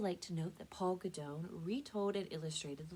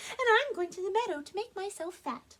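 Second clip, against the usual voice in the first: high little billy goat voice; very small goat voice; 9 dB louder than usual. Acted. Tiniest goat tells the troll his plan, small high voice. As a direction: squeak it out like the tiniest kid goat. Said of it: "in his very small voice".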